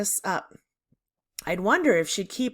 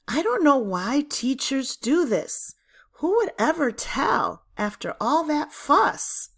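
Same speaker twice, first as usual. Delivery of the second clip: exasperated; 6 semitones higher than usual. A narrator's reading. Narrator's exasperated aside.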